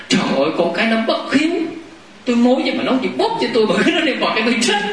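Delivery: a hint of an aggrieved old mother; complaining old woman. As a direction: whine like an old woman sick of her kids. Old patient complains to visitor.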